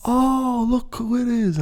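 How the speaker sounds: very high, loud falsetto squeal; mock excited greeting. Greeting someone in a car that pulled up, high falsetto. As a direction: coo it loudly, way up high.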